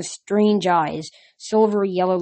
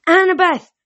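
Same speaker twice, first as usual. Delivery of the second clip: high, loud call of her name; yell; 11 semitones higher, 8 dB louder than usual. A narrator's reading. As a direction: call after a friend falling. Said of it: "I yelled".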